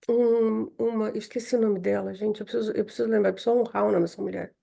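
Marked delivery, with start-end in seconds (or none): none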